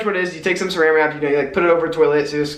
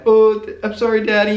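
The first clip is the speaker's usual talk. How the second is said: put-on higher, louder meek kid voice; little kid apologizing; 6 semitones higher, 4 dB louder than usual. Fourth grade boy apologizing to his dad, higher, louder kid voice. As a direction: play the scared fourth grader saying sorry. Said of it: "little, like, fourth grade me".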